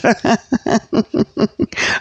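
soft chuckle run; laugh. Real, not acted. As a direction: keep chuckling. Laughs at own joke.

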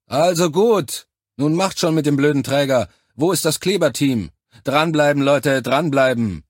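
loud, high commanding calls; shouted urging. A narrator's reading. Officer urging soldiers on with the carrier, loud shouting. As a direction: drive the team on.

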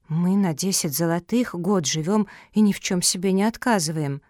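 ordinary delivery

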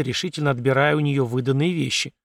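plain reading voice

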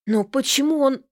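shocked question. Acted.

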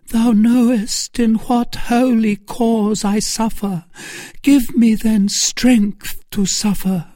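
high, impassioned supplication; praying aloud. A narrator's reading. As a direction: pray in suffering, pitched high. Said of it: "began to pray".

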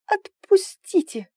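high, slow frightened squeak. A narrator's reading. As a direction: squeak out the plea. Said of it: "пискнула девушка".

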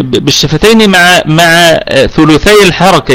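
slow, careful teaching of rawm; each word drawn out for a student; slow explanation. Shown as a demonstration. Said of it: "الروم أقف على الكلمة بالسكون ثم أشير".

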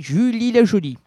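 high, loud put-on voice; bold announced name. Host proclaims his guest's name in the bar scene, high loud voice.

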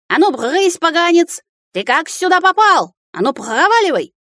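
высокий возмущённый голос картавого домового; выкрикни сердито, картавя на «р»; картавый возмущённый крик. A narrator's reading. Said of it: "возмущённая тирада, выкрикнутая мужским картавым голосом". (возмущённо кричать картавым голосом)

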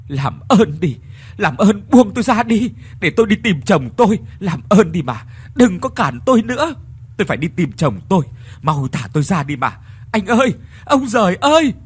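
loud, high desperate pleading; full of anguish. Acted. Ngọc begging the crowd to release her, anguished pleading. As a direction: fight to break free, begging through tears. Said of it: "giọng cô đầy thống khổ".

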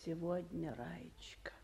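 breathy whisper, almost no voice. Acted. Chairwoman quietly calling secretary, whispered. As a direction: say the name under your breath.

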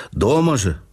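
ordinary delivery

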